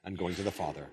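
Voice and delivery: low, hushed Bible reading; measured reading from scripture. Acted. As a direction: read the passage solemnly, softly and low. Reads scripture aloud.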